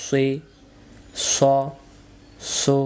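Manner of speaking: সুই, স, সু each said with rounded lips; rounded mouth. Shown as a demonstration. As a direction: give সুই, স, সু a full round vowel, one at a time. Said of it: "এখানে মুখটা একটু গোল হবে". (সুই, স, সু মুখ গোল করে)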